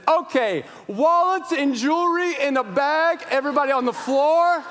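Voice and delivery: high, loud hold-up commands; shouting robber. Pretend. Robber to restaurant crowd, hammed-up shouted commands. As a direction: bark orders like an armed robber. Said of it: "as if I walked out there and said".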